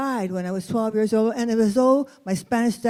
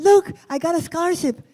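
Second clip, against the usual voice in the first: higher and louder, bursting with pride; proud announcement; 7 semitones higher, 5 dB louder than usual. Retold or imitated. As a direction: show off the news eagerly. Proudly tells teacher about scholarship.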